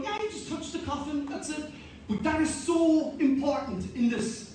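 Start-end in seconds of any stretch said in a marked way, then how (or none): 0.0-1.6 dismissive shrug. Retold or imitated. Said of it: "We kind of go".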